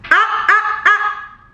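high drawn-out ah; mock scolding. Vlogger playfully scolding herself about the camera angle. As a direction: tease yourself.

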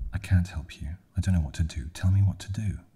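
loud, rising panic; panicked. A narrator's reading. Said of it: "she said, as if in a panic".

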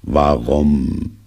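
long, droning donkey sigh of a word; gloomy, held-out question; sad pondering. A narrator's reading. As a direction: stretch it out mournfully. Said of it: "dachte er traurig bei sich".

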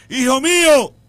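slow, loud, weighty declaration; solemn proclamation. Acted. The Lord speaking to his son on the plane, slow solemn proclamation. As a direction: proclaim each word slowly like a divine voice.

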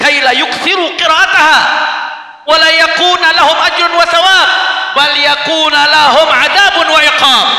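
high, loud Arabic declamation; acted command. Preacher voicing Iblis giving his devils their mission, loud acted command.

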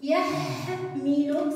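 يحمل split slowly into syllables; slow syllable reading. Shown as a demonstration. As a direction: sound out يحمل for children. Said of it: "سأحد مقاطع كلماتها". (تقطيع يحمل إلى مقاطع ببطء)

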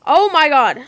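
loud startled yell; shocked shout. Real, not acted. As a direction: yell it in a fright. Sudden fright in game.

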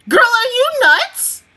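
high, loud, slowed challenge; incredulous scolding. Acted. Viewer scolding a show's character, incredulous outburst. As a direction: call her out in loud disbelief.

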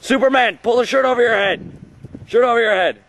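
high, loud calling out; excited cheering. Pretend. Friend urging Paul's victory celebration, high excited yell. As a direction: yell directions to a celebrating pal.

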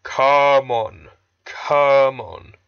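loud, drawn-out heaving effort; puffing. A narrator's reading. Gordon pulling the coaches away, puffing hard. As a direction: heave each "come on" like a starting engine. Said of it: "he puffed to the coaches".

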